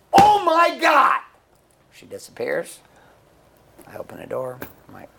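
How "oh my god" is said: huge high, drawn-out shout; exasperated yell. Retold or imitated. Husband reacting to his screaming wife, exasperated drawn-out yell. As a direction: bellow it slowly in disbelief.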